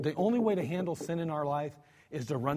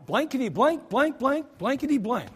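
mock cussing; 7 semitones higher than usual. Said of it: "a lot different than going".

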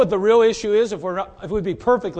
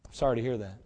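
much softer and lower, understated; subdued reply; 9 semitones lower, 9 dB quieter, faster than usual. Acted. Preacher answering an upset wife, quiet low-key reply.

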